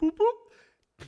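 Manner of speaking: 1 radio beep imitation.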